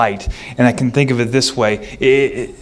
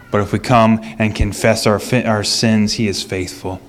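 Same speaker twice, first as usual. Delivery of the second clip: ordinary talk, nothing marked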